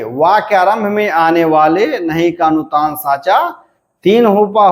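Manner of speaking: normal talking voice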